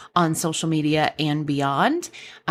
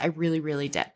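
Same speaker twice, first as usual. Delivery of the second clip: ordinary talk, nothing marked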